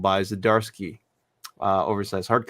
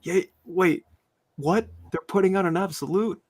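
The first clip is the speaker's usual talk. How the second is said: higher, hammed-up double take; a pitched-up, playful what; mock disbelief; 9 semitones higher than usual. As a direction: act caught off guard by the news. Retold shock at news.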